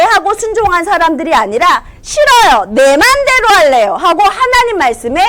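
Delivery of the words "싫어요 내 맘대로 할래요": louder, higher whiny refusal; defiant child. Acted. Sinners refusing God's word, defiant. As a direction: stamp your foot and refuse.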